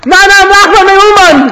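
loud, high shout of Na!; a high mock chant shout.

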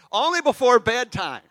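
high, loud, slow mimicking of a fussy mother; mother's instruction. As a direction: parody her bossy rule. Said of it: "the over-instruction of my mother".

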